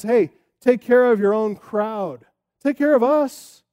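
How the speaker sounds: demanding crowd. Retold or imitated. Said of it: "Physician, heal yourself" means".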